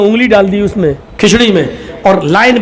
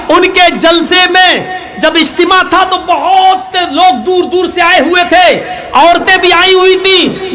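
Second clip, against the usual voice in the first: fiery preaching; 8 semitones higher than usual. Real, not acted. Preacher telling the gathering a story, fiery. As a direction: preach it out to the whole crowd.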